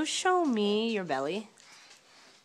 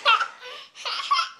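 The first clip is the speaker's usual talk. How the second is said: high giggle; 3 dB louder than usual. Real, not acted. Parent laughs during tickle.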